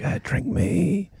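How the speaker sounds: soft, slow, high whispery can voice; seductive whisper. Acted. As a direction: whisper it slowly, like a tempting cold drink. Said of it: "it's whispering my name".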